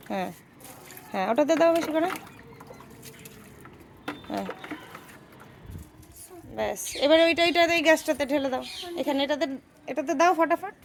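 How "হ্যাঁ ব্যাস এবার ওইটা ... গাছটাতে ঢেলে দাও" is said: usual speaking voice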